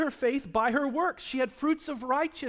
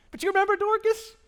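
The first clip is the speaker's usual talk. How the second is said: high, loud goofy voice on Dorcas; silly funny voice; 11 semitones higher, 7 dB louder than usual. Pretend. Pastor jokes about the name Dorcas in a goofy voice. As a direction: ham up the odd name for a laugh. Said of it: "'cause I can't do that with a straight face".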